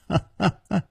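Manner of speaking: short ha-ha chuckle; brief, amused laugh before replying; light laugh. A narrator's reading. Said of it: "无花笑着说". (轻快地笑几声)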